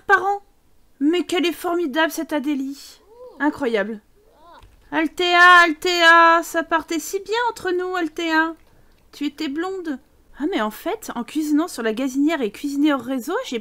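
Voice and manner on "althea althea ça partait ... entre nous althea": appelle Althea fort et plus aigu; reproche théâtral. Pretend. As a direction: reproche mélodramatique à une Sim. Scolds Sim dramatically. (appel dramatique, plus aigu, à Althea)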